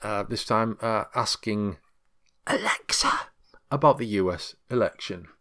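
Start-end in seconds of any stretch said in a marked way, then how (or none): 2.5-3.1 breathy hush on just Alexa; whispered wake word. Acted. Host whispers Alexa so the device stays asleep. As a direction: whisper the name so the speaker won't wake.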